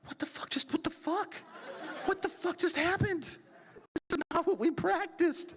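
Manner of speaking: high-pitched, comic disbelief; mock shock. Two sons watching dad botch the divorce talk, shocked. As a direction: play up the stunned squeal.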